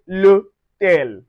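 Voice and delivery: l'hôtel said slowly and joined; contracted l'hôtel. Shown as a demonstration. Teacher slowly modelling the l'hôtel contraction. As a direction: slowly join l'hôtel into one word. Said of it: "pronounce it together with a contraction".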